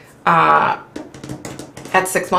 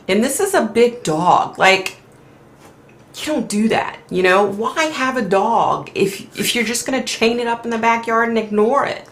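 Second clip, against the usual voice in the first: upset; 6 semitones higher than usual. Real, not acted. Upset rant about chained dog.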